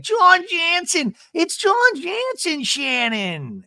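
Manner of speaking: very high, slow drawn-out name; hammed-up high slow announcement; mock dramatic reveal. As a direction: drag out the name in shock. Imitating Gina peering over fence.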